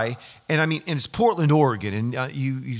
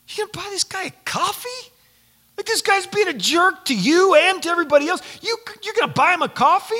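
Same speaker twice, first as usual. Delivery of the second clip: high, mock-indignant crowd voice, much higher; incredulous onlooker; 4 dB louder than usual. Bystander scolding Josh for buying the jerk coffee, high incredulous. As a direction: play the outraged bystander, pitched way up.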